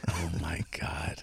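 breathless whispered aside; hushed amazed whisper. Real, not acted. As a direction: whisper it slowly in disbelief. Stunned by funny story.

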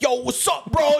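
high, hammed-up bro call; a mock "yo, what's up, bro" hollered high; mock bro greeting. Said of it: "It's like".

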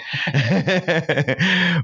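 short high burst of laughter; quick amused laugh. Real, not acted. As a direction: laugh at your own joke. Host laughs at own joke.

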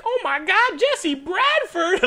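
starstruck fan squeal. Retold or imitated. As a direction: shriek the name like a fan spotting a star.

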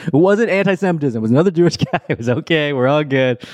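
laughing reassurance. Real, not acted. Joking mock reassurance.

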